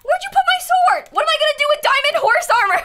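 high, loud and fast; frantic demand. Real, not acted. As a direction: fire it off in a high panic. Repeats demand, more frantic.

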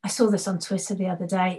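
usual speaking voice

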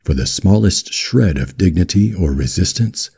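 ordinary delivery

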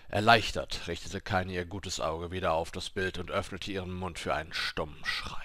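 ordinary delivery, nothing marked

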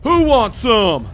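usual speaking voice

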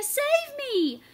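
very high, loud cry for help; desperate plea. A narrator's reading. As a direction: scream for rescue. Tamaya trapped, pleading.

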